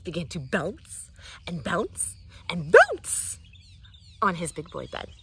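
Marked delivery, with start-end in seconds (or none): 2.7-3.4 high bouncy word. Acted. Big bounce word voiced up.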